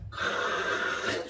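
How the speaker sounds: 큰 with a long, rasping breathy onset; drawn-out emphasis. Shown as a demonstration. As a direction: stretch the opening k of 큰 hugely. Illustrates how big the voice is.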